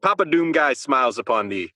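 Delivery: ordinary talk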